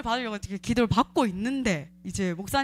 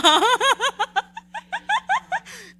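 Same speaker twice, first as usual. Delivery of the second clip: high laugh; 6 dB louder than usual. Real, not acted. Laughing at the exposed demon.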